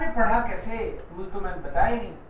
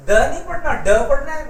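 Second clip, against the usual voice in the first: ड pushed hard, louder and higher, set against द; emphatic correction; 5 semitones higher, 7 dB louder than usual. Shown as a demonstration. Teacher correcting the class's द to a hard ड, louder. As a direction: stress ड पढ़ना over द, raise the voice. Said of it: "डी को ड पढ़ते हैं हम लोग".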